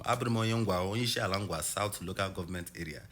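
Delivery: playful Nigerian accent. Shown as a demonstration. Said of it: "I can talk with a Nigerian accent".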